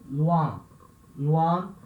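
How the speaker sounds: "loin" said as French, nasal oi; French pronunciation. Shown as a demonstration. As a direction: model "loin" twice in French, the teacher's demo. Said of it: "Com esse som de U mesmo".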